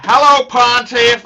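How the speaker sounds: loud, high hammed-up greeting in his accent; Blue Shirt's accent. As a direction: belt it out, copying his accent. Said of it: "I like his accent".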